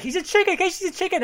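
high, frantic retold alarm; alarmed warning. Lone guy warns everyone he's a chicken, mock alarm.

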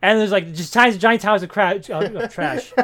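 ordinary talk, nothing marked

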